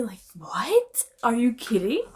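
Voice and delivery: whispered disbelief; whispered are you kidding; incredulous whisper. Real, not acted. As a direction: hiss it out, baffled. Incredulous aside about jealousy.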